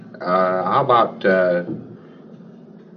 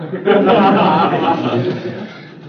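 loud high burst of laughter; hearty laugh; 9 semitones higher, 7 dB louder than usual. Real, not acted. Examiner laughs at odd answer.

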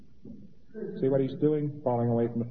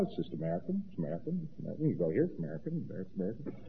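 mock weary murmur; 4 semitones higher, 9 dB quieter, much faster than usual. Mock-dreary repeated American.

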